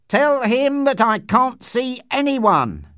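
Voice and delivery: high, loud, drawn-out call; calling back. A narrator's reading. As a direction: shout a slow refusal through a door. Voice answers from behind closed door.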